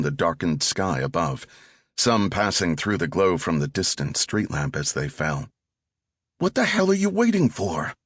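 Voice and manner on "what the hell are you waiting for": put-on much higher, impatient young thug voice; pushy teenage getaway driver. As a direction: snap at him from the driver's seat. Driver teen impatient in getaway car.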